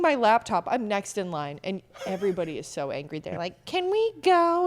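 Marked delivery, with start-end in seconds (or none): 3.7-4.7 high, slow, annoyed plea from the queue; impatient demand. Retold or imitated. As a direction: whine it like a fed-up traveller. Impatient crowd in line.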